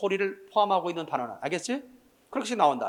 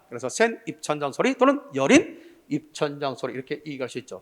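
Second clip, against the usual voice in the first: usual speaking voice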